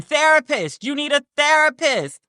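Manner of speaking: you need help, said high and fed up; exasperated. Real, not acted. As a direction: tell her she needs a therapist, fed up. Exasperated aside to the audience.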